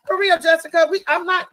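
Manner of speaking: yelling across the room. Real, not acted. Calling out to Jessica's mom.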